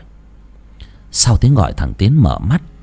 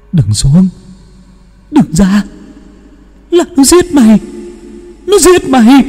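piercing, much higher desperate plea; anguished warning cry; 6 dB louder than usual. Acted. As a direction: warn him with the last breath. Dying boy warns his friend. (kêu thảm thiết)

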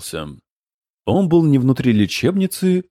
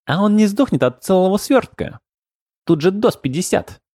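high goblin voice; 7 semitones higher than usual. A narrator's reading.

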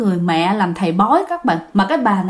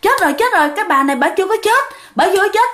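alarmed exclamation; 8 semitones higher, faster than usual. Retold or imitated. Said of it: "mọi người nói".

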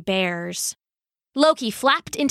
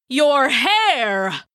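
higher, drawn-out cry of dismay in a big god's voice; shocked outcry; 4 semitones higher, 4 dB louder than usual. Acted. Thor to Sif on seeing her shorn, shocked outcry. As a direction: cry it out, aghast. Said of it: "initial squall of his anger".

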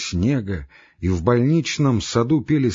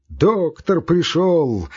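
higher, drawn-out slow cry; shout; 6 semitones higher, 3 dB louder, much slower than usual. A narrator's reading. Mad patient announcing the doctor's arrival, mocking slow shout. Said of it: "крикнул он и захохотал".

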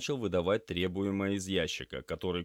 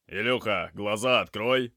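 raised voice to rouse a patient; loud command; 3 semitones higher, 8 dB louder than usual. A narrator's reading. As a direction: call firmly to someone drifting off. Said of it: "громко произнёс Лёша".

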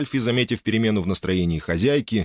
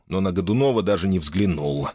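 ordinary delivery, nothing marked

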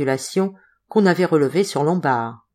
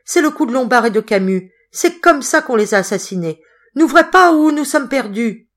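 high, loud fearful gasping; loud, high terrified pleading; panicked plea; 6 semitones higher, 5 dB louder than usual. Acted. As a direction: choke out the words in dread. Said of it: "Il râlait ces mots".

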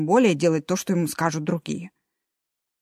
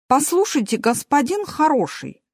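annoyed, higher and firmer; irritated; 7 semitones higher than usual. Acted. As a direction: tell him off with irritation. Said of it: "раздражённо сказал Мартин". (раздражённо)

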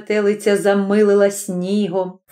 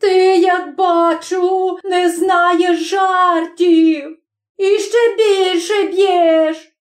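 high, slow, tearful complaint; pained wail; 9 semitones higher, much slower than usual. A narrator's reading. Chub beaten by the smith, pained high wail. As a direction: wail each word with hurt and vexation. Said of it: "заголосив Чуб таким голосом, в яким чути було і біль".

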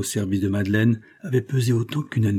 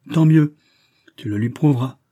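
emphatic retort; 5 semitones higher, 6 dB louder than usual. Acted. Verduret retorting to Fanferlot.

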